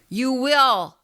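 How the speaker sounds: put-on old man yelling loudly; yelled. A narrator's reading. Old man yells his answer to the narrator. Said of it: "The old man yelled loudly".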